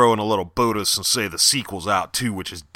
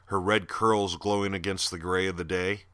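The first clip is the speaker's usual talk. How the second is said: hushed, low storytelling read; quiet horror story reading voice; story narration; 4 semitones lower, 8 dB quieter than usual. Acted. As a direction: draw listeners into a grim tale, softly. Reads his horror story aloud.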